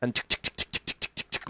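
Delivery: soft whispered tick tick of wheels; quiet mouth-click sound effect. Acted. Imitating wagon wheels.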